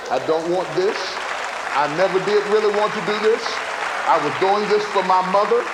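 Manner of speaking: loud, rising fed-up rant; fed-up declaration. Retold or imitated. Preacher voicing someone done pleasing others, rising to loud.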